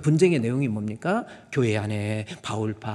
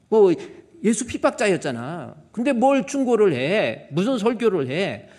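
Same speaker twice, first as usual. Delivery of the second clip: high, loud sneering complaint; mock the preacher with scorn; scornful church member; 8 semitones higher, 5 dB louder than usual. Church member sneering at the pastor with a loud high scorn.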